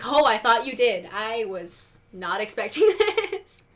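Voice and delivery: loud, then drawn-out stunned reaction with laugh; mock shock. Viewer as stomach drops at the aside, mock shock. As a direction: play up being caught off guard.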